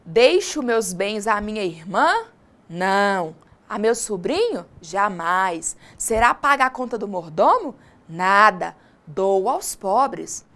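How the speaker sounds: "mordomo?" asked, then a short falling "Nada." and a slow "Dou aos pobres."; read as questions and answers. Shown as a demonstration. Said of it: "pontuou desse jeito".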